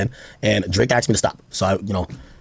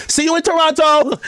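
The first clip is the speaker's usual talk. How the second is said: high mocking voice of [name]. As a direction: sing it out high and teasing. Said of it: "[name], like".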